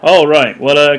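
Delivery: sudden loud reset; catching oneself loud. Real, not acted. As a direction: snap back loudly to normal. Catching oneself after narration.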